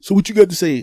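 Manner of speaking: high, loud challenge; confrontational demand. Retold or imitated. Boyfriend confronts the man accused of the remark, demanding. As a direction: demand an answer loudly.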